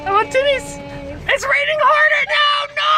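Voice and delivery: high wailing shout at the downpour; frantic shout. Real, not acted. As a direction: yell it out in mock despair. Dramatic complaint about the rain.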